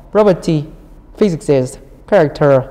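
"Property", "physics", "character" each stressed up front, falling away after; first-syllable stress. Shown as a demonstration. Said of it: "là nhóm một". (nhấn âm đầu của "Property", "physics", "character")

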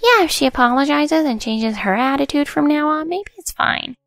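put-on high, smirking girlish lilt; smug. A narrator's reading. Jennifer setting terms for Karen's apology, smug. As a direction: grant forgiveness with a smirk, voice climbing. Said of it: "lifted her face from her fake crying and smirked".

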